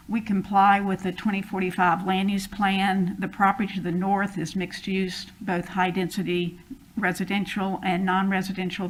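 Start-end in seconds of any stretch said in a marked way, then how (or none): none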